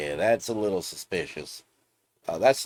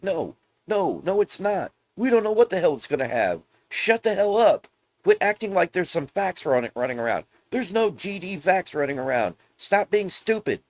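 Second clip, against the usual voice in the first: much higher and louder, yelling at rumor spreaders; exasperated rant; 10 semitones higher, 4 dB louder than usual. Retold or imitated. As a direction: rant at the gullible fans, all fed up. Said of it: "And it's like".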